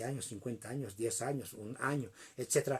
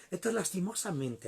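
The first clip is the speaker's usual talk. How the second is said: ordinary talk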